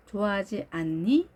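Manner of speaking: ordinary delivery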